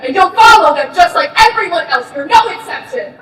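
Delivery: loud, furious lecturing; angry shout. Acted. To Miss Rembrandt, a loud angry scolding. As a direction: bark the regulations at her.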